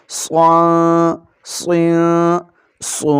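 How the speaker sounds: صاد said heavy, full and slow; heavy sad. Shown as a demonstration. Teacher showing the heavy reading of صاد. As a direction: give صاد a thick, heavy sound. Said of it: "صاد کو ہم نے موٹا پڑھنا ہے".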